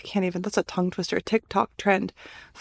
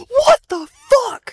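high, loud, slow cry of disbelief; shocked cry; 13 semitones higher, 8 dB louder, much slower than usual. Real, not acted. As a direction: squeal it in laughing shock. Shock at old photos.

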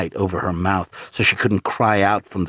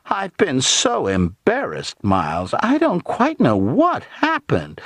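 high, slow mock embarrassment; little old aunt mimicking, nastily sweet; sickening nastiness; 8 semitones higher than usual. A narrator's reading. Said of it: "nastiness in her tone was actually sickening".

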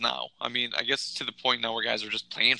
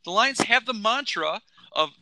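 heated rant; 6 semitones higher than usual. Acted. Continuing the rant.

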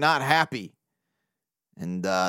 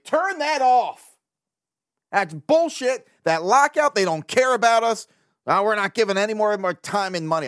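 mock angry rant; 5 semitones higher than usual. Imitating himself snapping when his wife turns on the game, mock angry. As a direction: rant like a fed-up fan.

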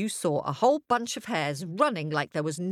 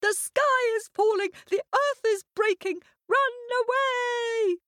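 much higher, frantic hare cry of alarm; panicked shout. A narrator's reading. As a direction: squeal it out like a terrified little hare. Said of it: "what did they cry?".

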